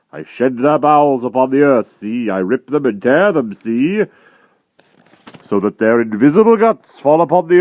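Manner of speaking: loud, high gloating brute; bloodthirsty giant. A narrator's reading. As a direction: savor every gory threat.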